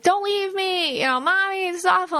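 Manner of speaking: put-on high whiny kid voice begging not to be left; crying child. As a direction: whimper like a small child clinging to mom. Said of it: "dropped your kid off at school and they're crying".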